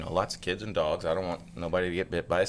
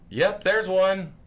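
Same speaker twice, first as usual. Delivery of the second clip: son's voice; 10 semitones higher, 6 dB louder, much slower than usual. Retold or imitated. Son voiced in snake story.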